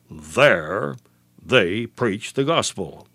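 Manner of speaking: low "There" held, then a pause; stress on THERE. Shown as a demonstration. Preacher answering with the one message, stress on "There". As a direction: slowly set "There" apart from the rest. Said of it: "So why the stress at this point?".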